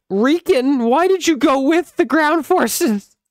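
high, loud, frustrated yelling at the screen; exasperated shout. Real, not acted. Player yelling at a unit.

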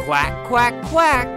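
quacking like a duck; simple playful duck quacks. Retold or imitated. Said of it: "Can you make the sound of a duck?".

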